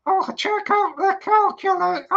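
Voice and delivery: the dummy cries out in alarm; a high little puppet squeal; shrieking dummy. Acted.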